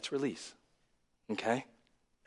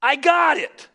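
loud, much higher mock dad shout; dad's shout; 14 semitones higher, 11 dB louder, slower than usual. Fed-up dad answering his worrying kid, loud shout. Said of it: "dads would at some point say".

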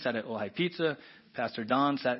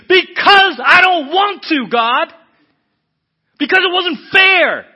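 very high, very loud protest to God; anguished shout; 22 dB louder, slower than usual. Acted. Believer finally admitting why to God, screaming protest. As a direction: scream out the long-held grievance. Acted climactic cry to God.

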